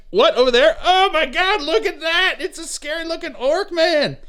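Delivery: high, excited mock gasp at an orc; excited soldier quote. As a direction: squeal it like a startled trooper. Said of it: "And he's like".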